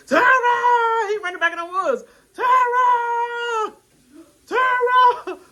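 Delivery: high, loud repeated calling out; imitating a loud annoying call; mock yelling a name. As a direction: yell the name over and over. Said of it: "She was like, I got it Michael".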